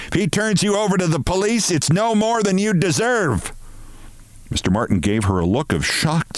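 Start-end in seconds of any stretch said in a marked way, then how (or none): 0.1-3.5 angry shout. A narrator's reading. Woman threatening colleague with police, high indignant shout. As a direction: rattle out the threat, pitched way up. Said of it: "she shouted".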